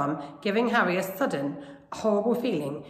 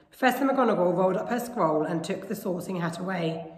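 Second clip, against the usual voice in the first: usual speaking voice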